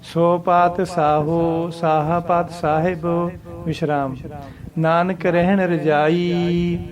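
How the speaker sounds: slow, half-sung recitation resting after ਪਾਤ ਸਾਹਿਬ; pause after Sahib. Shown as a demonstration. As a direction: chant it, rest after Sahib, then Nanak ਰਹਿਣ ਰਜਾਈ. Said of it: "ਪਾਤ ਸਾਹਿਬ ਦੇ ਉੱਤੇ ਵਿਸ਼ਰਾਮ ਆਊਗਾ". (ਸਾਹਿਬ ਤੋਂ ਬਾਅਦ ਵਿਸ਼ਰਾਮ)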